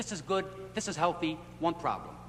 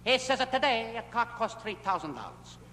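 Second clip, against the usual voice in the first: ordinary talk, nothing marked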